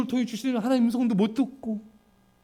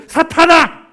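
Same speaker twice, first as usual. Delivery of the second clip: much higher, loud commanding shout; stern rebuke; 7 semitones higher, 9 dB louder, slower than usual. Retold or imitated. Jesus rebuking Peter with a loud, high shout. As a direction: drive Satan off with a booming cry. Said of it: "예수님 말했어요".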